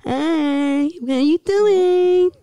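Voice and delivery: put-on baby talk. Greeting partner on an anniversary prank call, baby talk. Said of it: "baby talk trend".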